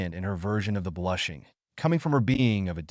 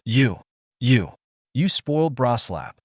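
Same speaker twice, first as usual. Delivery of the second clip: stammering, louder outburst; sputtering fury; 4 dB louder than usual. Acted. Midna to Naruto, stammering angry outburst. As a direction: choke on the words in outrage.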